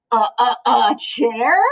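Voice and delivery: slow nervous stammer. Acted. Turkey invited to the table, frightened stammer. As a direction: stumble over the words in fright. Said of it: "Turkey stammered".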